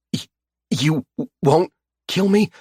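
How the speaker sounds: high, stammering, hopeful fear; wounded captive asking for mercy, high and stammering; fearful question. Acted. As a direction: ask it timidly, voice high and shaking. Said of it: "he questions".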